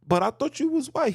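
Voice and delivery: high, disbelieving voice; mock shock at being told; incredulous quote. Someone surprised at his race, high and incredulous. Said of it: "He was like".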